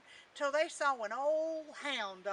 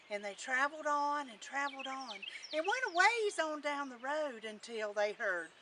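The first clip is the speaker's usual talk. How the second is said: usual speaking voice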